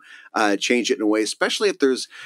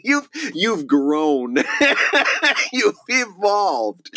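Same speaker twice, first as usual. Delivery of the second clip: laughing; 8 semitones higher, much slower than usual. Real, not acted. Giggling through words.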